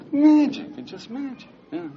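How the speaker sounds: very high, airy marvelling; dreamy wonder. Pretend. Dreamy recall of first love.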